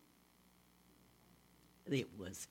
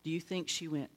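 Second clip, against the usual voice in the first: ordinary delivery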